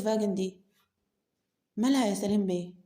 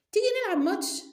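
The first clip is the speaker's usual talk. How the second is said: high, loud teasing challenge; challenging invite; 13 semitones higher, 4 dB louder, faster than usual. Acted. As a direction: toss out the dare up high and loud. Salim challenges to a match.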